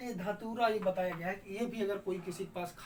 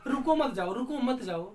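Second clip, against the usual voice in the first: loud, raised run-together command; louder, higher example shout to someone; telling someone; 3 semitones higher, 8 dB louder than usual. Shown as a demonstration. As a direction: call it out loudly without a pause. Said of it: "जैसे किसी को आप बोलिए".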